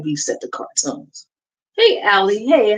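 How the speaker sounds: usual speaking voice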